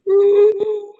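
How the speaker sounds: high, short imitated sob; a quick mock cry like a child in training; a hammed-up little sob.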